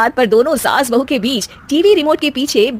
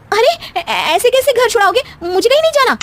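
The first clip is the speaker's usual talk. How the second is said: much higher, insistent refusal; alarmed protest; 13 semitones higher than usual. Acted. Wife refusing to be sent to her parents, alarmed. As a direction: dig in, you won't go.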